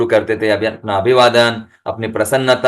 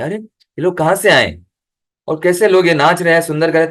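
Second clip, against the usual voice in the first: higher, wondering voice of passersby; amazed onlookers; 6 semitones higher than usual. Retold or imitated. Passersby amazed at dancing devotees, higher and wondering. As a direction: lightly play the astonished bystander. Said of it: "अचंभित होते हैं".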